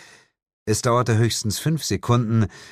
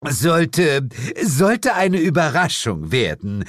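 higher, halting, choked-up stammer; flustered devil; 8 semitones higher, 4 dB louder, slower than usual. A narrator's reading. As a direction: stammer an excuse while recovering. Devil stammers an excuse after choking. (verlegen stotternder Teufel)

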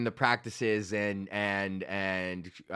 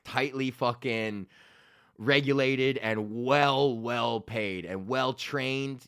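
slow emphasis; slower than usual. Real, not acted. Listing ideal job slowly.

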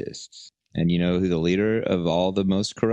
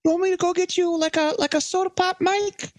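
much higher, stammering meek voice, a bit fast; groveling Fauci. Pretend. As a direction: fumble out an eager-to-please apology. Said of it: "he'll go".

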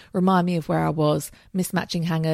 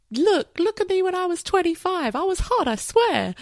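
pleading show-off; 11 semitones higher than usual. Pretend. Imagined plea to future partner.

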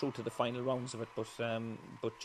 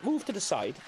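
bossy hostess; 8 semitones higher than usual. Retold or imitated. Host ordering him, voiced.

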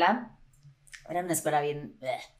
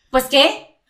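higher and louder, mock puzzled; mock northern husband; 6 semitones higher, 9 dB louder than usual. Husband answering at the dinner table, loud mock bafflement. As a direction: play the baffled husband, hammed up. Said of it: "Como que".

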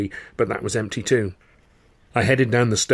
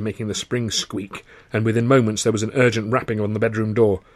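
ordinary delivery, nothing marked

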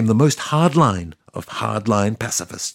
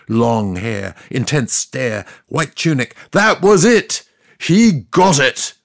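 ordinary talk, nothing marked

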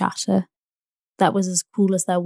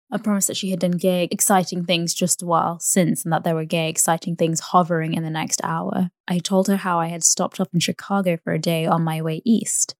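ordinary delivery

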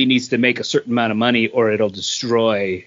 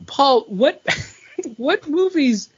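high teasing voice; 13 semitones higher than usual. Real, not acted. Teasing Kate's movie taste.

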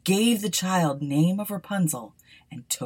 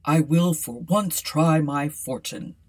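king's son; 3 semitones lower, 4 dB louder than usual. A narrator's reading. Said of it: "he said".

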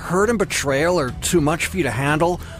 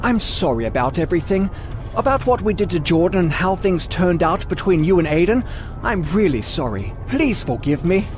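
put-on higher, apologetic British-accented voice; 9 semitones higher than usual. A narrator's reading. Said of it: "I loved his British accent".

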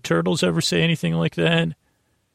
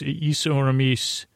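slow deliberate; much slower than usual. Shown as a demonstration. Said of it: "Or is that is or mis?".

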